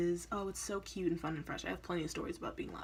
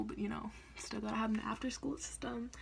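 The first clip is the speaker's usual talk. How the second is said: whispered aside; 4 semitones higher, 4 dB quieter than usual. Real, not acted. Sarcastic aside about high school.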